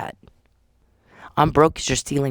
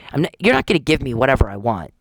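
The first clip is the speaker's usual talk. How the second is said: ordinary talk, nothing marked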